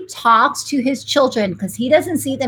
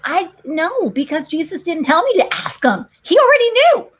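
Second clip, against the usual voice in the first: indignant retort; 7 semitones higher, 3 dB louder than usual. Retold or imitated. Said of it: "I'm like".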